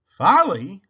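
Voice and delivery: loud incredulous question; baffled echo. A narrator's reading. Mister Watson puzzled by Baby's word, incredulous. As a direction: repeat the word in disbelief.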